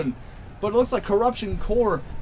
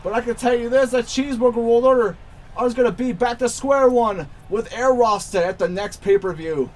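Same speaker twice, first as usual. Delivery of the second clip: wrestling commentator; 6 semitones higher than usual. Acted. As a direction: call the finish like a hyped announcer. Live wrestling play-by-play.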